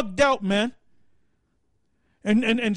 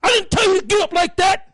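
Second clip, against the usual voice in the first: drill sergeant; 5 semitones higher than usual. Retold or imitated. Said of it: "played the hell out of a goddamn drill sergeant".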